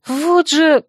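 sharp angry exclamation; angry outburst. A narrator's reading. Heroine scolding her fox friend, angry. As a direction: snap it out in annoyance. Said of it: "разозлилась я".

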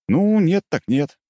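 slow, drawn out shrug of a line; mock indifference. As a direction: play it like you don't care. Hero pretends to give up.

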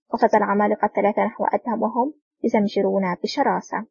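ordinary delivery, nothing marked

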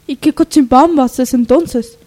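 changed voice. Acted. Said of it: "le ha vuelto a cambiar la voz a [name]".

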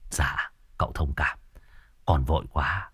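sheepish whisper. Acted. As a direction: murmur an apology to the master. Hùng apologizing. (thì thầm xin lỗi, rụt rè)

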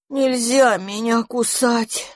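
slow, drowsy mumble; barely moving the tongue from sleepiness; sleepy. A narrator's reading. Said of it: "еле ворочая языком".

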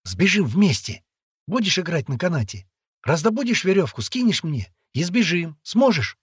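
high, loud urgent plan; excited plotting. A narrator's reading. Boy proposing escape to the girl, excited.

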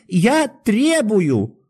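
very high, slow, strained demand; trembling cry. A narrator's reading. Doctor makes demands of the guard, desperately crying out. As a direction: stretch the demand into a shrieky wail. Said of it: "крикнул Андрей Ефимыч, дрожа всем телом".

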